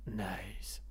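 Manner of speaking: soft unvoiced signature nice; whispered nice. Shown as a demonstration. Host whispering the show's trademark word. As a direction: breathe the word out. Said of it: "notre marque de fabrique".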